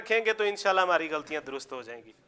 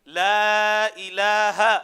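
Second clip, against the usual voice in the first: loud, very drawn-out Arabic recitation; slow, forceful teaching chant; model recitation; 7 dB louder, much slower than usual. Shown as a demonstration. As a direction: stretch each syllable firmly for students to copy. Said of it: "جس کو سختی سے ادا کرنا ہے".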